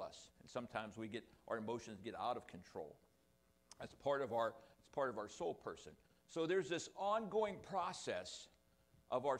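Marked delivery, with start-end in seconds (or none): none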